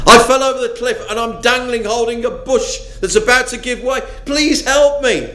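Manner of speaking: high, fast panicked begging, slowing on the last plea; desperate pleading. Acted. Dangling tourist begs the voice above, high and panicked. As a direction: beg for rescue while slipping off a cliff.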